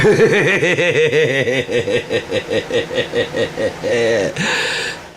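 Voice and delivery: long high laugh. Real, not acted. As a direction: laugh it off at length. Laughs after the boast.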